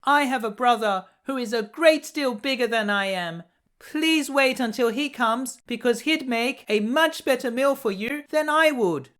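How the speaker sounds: small pleading goat, high and timid; little goat, frightened. A narrator's reading. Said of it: "he cried".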